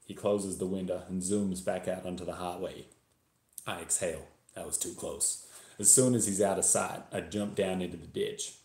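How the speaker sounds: put-on story read in a Texan accent; attempted Texas accent. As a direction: narrate as a Texan, imitated accent. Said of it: "I'm going to attempt a Texas accent".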